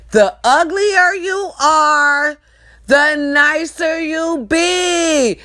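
slow emphatic rule. Real, not acted. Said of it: "slow emphatic rule".

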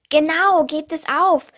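loud, emphatic jeer; emphatic agreement. A narrator's reading. Big cousin telling the chicks to give up, loud and emphatic. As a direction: back up the put-down forcefully. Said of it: "bekräftigte unser großer Cousin".

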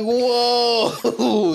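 high-pitched retold gasp of astonishment; amazed whoa. Podcaster retelling his reaction to the coach's slap, amazed. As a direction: exclaim it high, imitating your stunned reaction.

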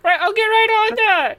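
squirm and stall like a guilty borrower; flustered neighbor. Acted. Neighbor Jason stalls over the borrowed mower, high and flustered.

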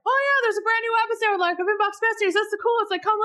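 high, fast, gushing sales pitch; mock excited hype. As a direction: squeal it like a bubbly over-eager promo. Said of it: "it's not just like".

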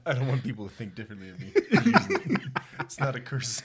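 loud, laughing through the words; mock the speaker while cracking up; teasing a friend while laughing hard; laughing mockery.

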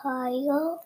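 usual speaking voice

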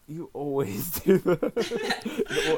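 slow, laughing through the words. Real, not acted. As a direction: laugh while teasing him. Teasing friend about his rants.

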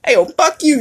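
high indignant "What the fuck?" then a brash "fuck you"; outraged fuck. Shown as a demonstration. Showing listeners the outraged, combative fuck. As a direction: show the angry, confrontational fuck. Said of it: "different tones mean different".

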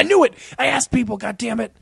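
mock outburst. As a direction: blurt it then sag. Mac caught faking cancer.

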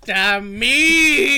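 mock self curse.

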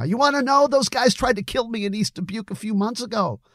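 very high, agitated yelling. A narrator's reading. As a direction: pour it out in a high, upset yell. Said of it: "Billy was yelling now".

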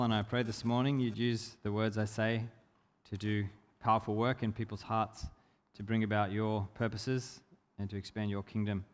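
low, soft praying voice; prayer. Real, not acted. Preacher prays to Father God before starting, softly praying. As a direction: pray quietly and low, unhurried.

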